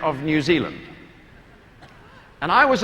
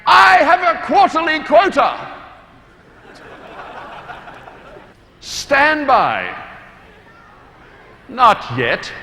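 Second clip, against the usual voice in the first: higher, louder mock-grand oration; mock sales agent speech; 6 semitones higher, 4 dB louder, slower than usual. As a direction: deliver a sales quota like a famous speech. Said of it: "standing in front of all the people saying".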